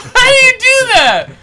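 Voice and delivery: very high, loud outcry; incredulous yell. Real, not acted. Player to rival who keeps winning, incredulous yell. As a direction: yell in disbelief at the rival's trick. Beaten in game.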